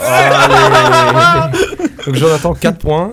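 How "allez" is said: excited laughing cheer. Real, not acted. Winner revealed, cheering.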